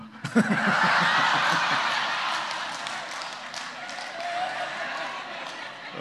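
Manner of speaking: a loud, high burst of laughter. Real, not acted. Preacher laughing loudly at her own joke.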